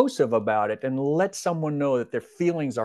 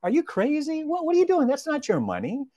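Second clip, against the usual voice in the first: kind, not explosive. Retold or imitated. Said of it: "Very kindly, not explosive, not violently".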